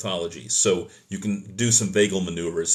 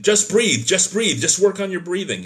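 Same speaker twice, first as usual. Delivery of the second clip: much higher, louder, exasperated mock of a careless medic; mock dismissive nagging; 10 semitones higher, 7 dB louder than usual. Careless medic telling a hyperventilating patient to breathe, high loud mocking parody. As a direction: parody the unhelpful caregiver barking orders in a high voice. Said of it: "but telling a patient".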